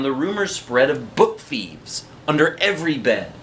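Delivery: plain reading voice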